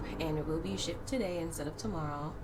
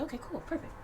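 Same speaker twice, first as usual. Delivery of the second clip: high chirpy okay; 11 semitones higher than usual. Retold or imitated. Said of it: "So I was like".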